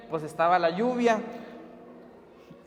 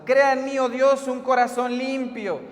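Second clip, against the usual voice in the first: raised, projected psalm recitation; preacher testing acoustics; 3 semitones higher than usual. Retold or imitated. Preacher reciting a psalm to test the hall, declaiming. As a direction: declaim it to the hall, slowing at the end. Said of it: "se puso a probar la acústica".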